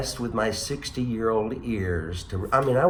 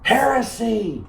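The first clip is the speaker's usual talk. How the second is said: mock outrage; 12 semitones higher, 5 dB louder, slower than usual. Mock outrage at Shakespeare legend.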